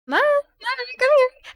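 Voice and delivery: high sing-song call to a dog. Retold or imitated. Dog owner at the park calling their dog, high call.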